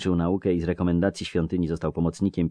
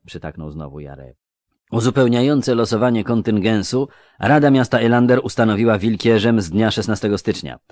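plain reading voice

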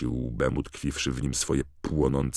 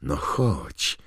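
usual speaking voice

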